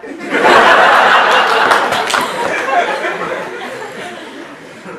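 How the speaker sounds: loud, high, breathless laughing fit; big burst of laughter. Real, not acted. Narrator bursting out laughing at the chart confession, loud. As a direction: crack up completely at the memory.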